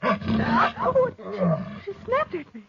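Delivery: startled. Acted. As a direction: jump at the snap. Startled by the panther's snap.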